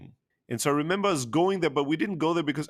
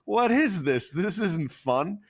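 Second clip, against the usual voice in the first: exasperated; much slower than usual. Retold or imitated. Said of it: "I'm like".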